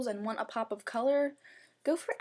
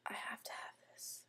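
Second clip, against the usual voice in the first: whisper; 17 dB quieter than usual. Retold or imitated. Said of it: "I was like".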